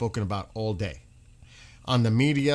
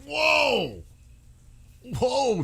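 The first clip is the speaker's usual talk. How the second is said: shocked whoa; 11 semitones higher than usual. Real, not acted. Streamer recoiling at breaking news with a shocked whoa. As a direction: blurt it out in shock.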